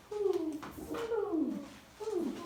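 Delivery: high, soft hoo like a bird; dove hoo. Acted. Teacher doing the dove for the class, soft high hoo. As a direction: coo it high and gently. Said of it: "the dove says".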